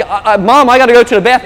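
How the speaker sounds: put-on a child who needs the bathroom now; Timmy calling to Mom in the car, urgent; needy kid. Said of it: "then Timmy's like".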